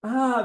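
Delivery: high knowing ah; a bright little realizing ah; mouse's ah. Acted. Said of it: "dice el ratón".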